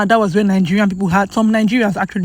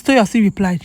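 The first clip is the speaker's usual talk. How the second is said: ordinary talk, nothing marked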